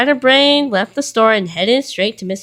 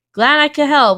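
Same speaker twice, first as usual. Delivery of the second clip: cheery call, higher and louder; shouting; 6 semitones higher, 5 dB louder than usual. A narrator's reading. Little Miss Helpful calls after Mister Rude, a bright shout. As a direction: call it out after a leaving customer. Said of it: "Little Miss Helpful shouted".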